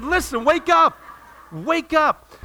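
high, loud acted yell; hammed-up exasperated shout; shouted wake-up call. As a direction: shout to jolt someone awake. Retold smack and wake-up call.

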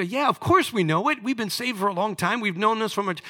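high, loud, mock know-it-all; smug churchgoers. Churchgoers mocked for shrugging off John three sixteen, high and smug. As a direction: brush it off like a veteran believer. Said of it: "because we say".

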